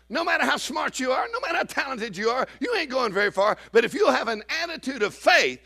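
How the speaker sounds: high-pitched, insistent preaching. Real, not acted. Preacher urging congregation on attitude of faith, high preaching. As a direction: drive each phrase home high and urgent.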